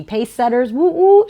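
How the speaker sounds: high, loud cheering for her school; a sudden burst of school spirit; excited cheer. Real, not acted. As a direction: whoop it up like a proud alum. Cheering for her old university.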